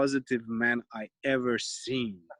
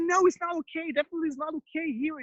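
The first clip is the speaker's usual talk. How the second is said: mother refusing; 12 semitones higher than usual. Retold or imitated. Mother forbidding her son's stunts, high and firm. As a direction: shut him down like a worried mother.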